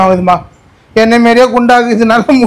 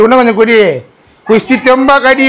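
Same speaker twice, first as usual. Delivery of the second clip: ordinary talk, nothing marked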